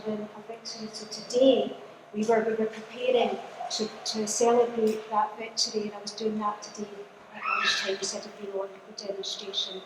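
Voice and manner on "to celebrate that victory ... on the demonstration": usual speaking voice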